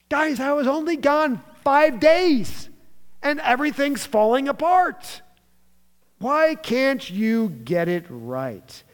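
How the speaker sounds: high, loud exasperated outburst, slowing at the end; frustrated. Acted. Jesus back after five days away, frustrated with disciples. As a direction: burst out at them, fed up. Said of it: "Jesus is frustrated at this point".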